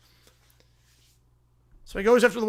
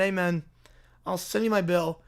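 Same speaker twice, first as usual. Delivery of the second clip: usual speaking voice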